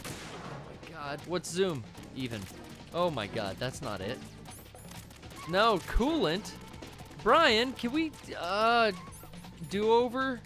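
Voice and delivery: ordinary delivery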